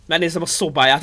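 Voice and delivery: ordinary talk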